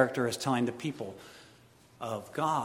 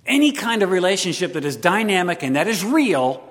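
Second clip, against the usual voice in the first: loud, rising preacher's climax; preaching at full force; 7 semitones higher, 12 dB louder than usual. Acted. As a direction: drive it home to the congregation.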